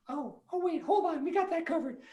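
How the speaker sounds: high, quick little mouse reassurance; eager mouse voice. Acted. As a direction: squeak eagerly to help. Mice reassuring.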